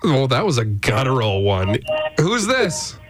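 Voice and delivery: usual speaking voice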